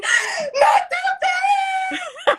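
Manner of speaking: very high, drawn-out mock wail; terrified child's scream. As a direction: shriek in hammed-up terror like a kid. Imitates daughter screaming at belt threat.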